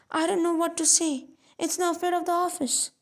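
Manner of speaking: put-on a flustered old clerk at a loss; embarrassed. A narrator's reading. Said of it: "in real embarrassment".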